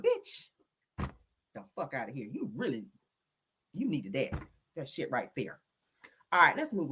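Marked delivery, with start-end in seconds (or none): 1.5-5.6 very low, soft grumble; hushed, simmering dismissal; fed-up muttering. Real, not acted. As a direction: mutter it under your breath, done with them. Fed up, winding herself down.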